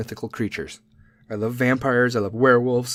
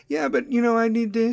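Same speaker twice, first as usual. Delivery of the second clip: mock whiny daughter; 9 semitones higher than usual. Daughter whining excuse.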